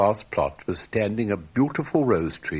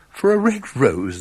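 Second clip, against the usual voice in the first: mock astonished cry; 5 semitones higher, 6 dB louder than usual. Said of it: "they cried".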